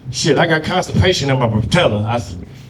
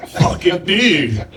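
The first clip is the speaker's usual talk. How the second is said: slow drawn-out dude; much slower than usual. Retold or imitated. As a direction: stretch the dude lazily like a surfer. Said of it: "develop that voice".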